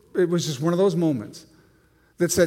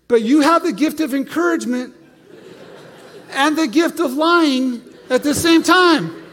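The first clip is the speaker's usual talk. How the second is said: mock retort; 9 semitones higher, 6 dB louder than usual. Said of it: "I'm going".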